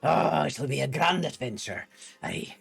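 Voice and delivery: hammed-up dwarven pirate accent. Streamer performing a requested voice for a viewer, dwarven pirate. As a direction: growl it like a playful pirate dwarf. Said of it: "Dwarven pirate yar".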